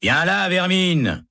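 high, slow contemptuous yell; harsh shout. A narrator's reading. Guard yelling contempt at Baca. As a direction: bark it at him with scorn. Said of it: "crie un gardien".

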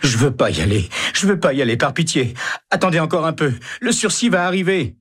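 high begging voice; pleading in tears. A narrator's reading. Condemned Baca begging the guards, pitched high in tears. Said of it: "se met à pleurer".

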